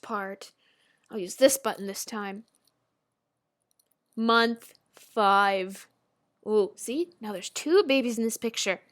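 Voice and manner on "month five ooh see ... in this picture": ordinary talk, nothing marked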